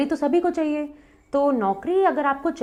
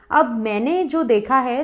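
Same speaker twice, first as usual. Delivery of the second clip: ordinary delivery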